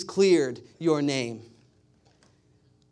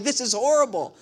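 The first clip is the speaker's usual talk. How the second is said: laughing, higher mock despair; 6 semitones higher, 3 dB louder than usual. Pastor retelling the scam call, in laughing mock despair. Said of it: "I'm like".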